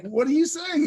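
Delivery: high, incredulous squeak; shocked. Retold or imitated. Retold shocked reply.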